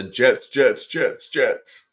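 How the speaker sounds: unbecoming imitation of a cheering fan; Lisa. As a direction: chant it in an altered, punchy fan voice. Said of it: "my best Lisa type voice".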